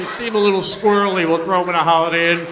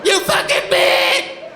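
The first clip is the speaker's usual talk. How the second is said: much higher, louder furious shriek, slowing at the end; screaming captive; 13 semitones higher, 6 dB louder, slower than usual. Retold or imitated. Girl in the hole screaming at Jodie Foster, furious shriek. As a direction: scream after someone leaving you in a pit. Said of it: "the girl's like".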